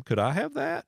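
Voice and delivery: high sweet "Could I have that?"; mock polite ask. Asking a stranger at Goodwill for the gear, mock sweet and high. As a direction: ask it way up high, all innocence. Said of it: "but I asked them".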